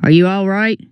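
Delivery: lightly booming character voice; Rooster. A narrator's reading. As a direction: call down with a mild boom. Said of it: "Rooster's voice came booming down".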